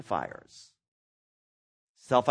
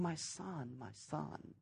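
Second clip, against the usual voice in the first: much softer, heartbroken murmur; grieving father; 16 dB quieter, much slower than usual. Retold or imitated. Abraham calling to the rich man in hell, soft grieving murmur. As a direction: hush it like a father's grief. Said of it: "Abraham is saying".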